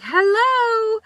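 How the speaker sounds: a loud, high "Hello?" like a fed-up teacher; exasperated hello. Retold or imitated. [name] calling out a rule-breaker, high and loud. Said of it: "I'm just like".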